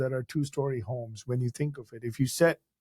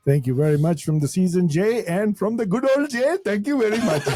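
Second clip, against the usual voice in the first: put-on playful Indian accent, higher; 5 semitones higher, 3 dB louder than usual. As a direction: slip into a joking Indian accent. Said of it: "Two guests in one".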